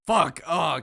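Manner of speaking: loud sudden curse; startled curse. Real, not acted. Streamer cutting off the woman by accident, loud curse. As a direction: blurt out the curse in alarm.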